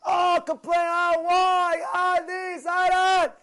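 high, loud mock wailing shout; hammed-up shouted griping; mock complaining. Preacher imitates people complaining to God, loud mock wail. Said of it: "You don't complain to God".

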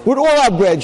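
giddy high-pitched delight; thrilled announcement. Acted. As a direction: blurt the amazing news to your wife. Husband thrilled telling his wife.

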